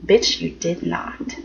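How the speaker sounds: low, quiet, slowed-down disbelief; a hushed, slow mock clapback; incredulous retort. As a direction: drop the voice and drag out the comeback. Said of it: "and I was just like".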